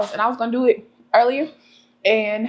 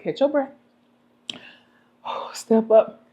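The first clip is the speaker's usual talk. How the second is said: whispered. Retold or imitated. Coaching herself before stage.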